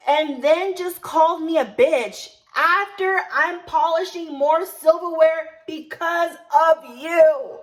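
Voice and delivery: slow, loud, hammered-out accusation; re-enacted yelling. Server with knife blames coworker, yelling angrily. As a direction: yell it word by word, drawn out. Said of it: "that's why I'm yelling".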